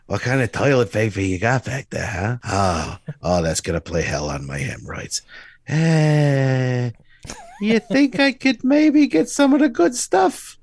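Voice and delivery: hammed-up old-man voice, jumping up high at the end; ridiculous old man. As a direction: play a wheedling old customer, hammed up. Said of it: "does it in the most ridiculous way".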